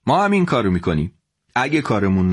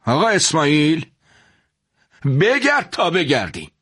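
put-on higher, bitter threat from an old merchant; upset warning; 6 semitones higher than usual. Rival shopkeeper threatening the father, upset and higher. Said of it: "با ناراحتی گفت".

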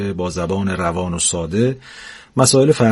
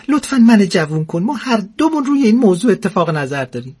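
much higher, breathless eager plea; eager and giddy; 10 semitones higher than usual. Acted. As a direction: beg excitedly to be young. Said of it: "با اشتیاق و هلهلکی گفت". (با اشتیاق و هیجان، صدای خیلی زیر)

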